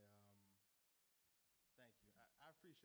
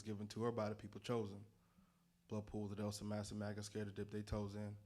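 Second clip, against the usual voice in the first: rhythmic spoken word, pressing forward; spoken word poetry; 24 dB louder than usual. Acted. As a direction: perform it like a slam poem. Performs spoken word rap.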